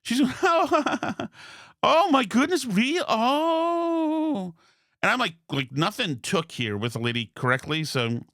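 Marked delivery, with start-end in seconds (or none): 0.4-4.5 retelling her giggly high oh-my-goodness; laughing reaction. Retold or imitated. Imitating the dentist laughing at his abuse story, high and giggly. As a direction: mimic her laughing shock, pitched way up. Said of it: "she was laughing".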